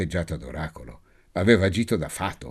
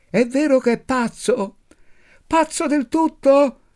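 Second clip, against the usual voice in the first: put-on a cheeky little girl yelling her question across the room; shouting little girl; 6 dB louder than usual. A narrator's reading. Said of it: "Gridò". (bambina che grida con voce acutissima)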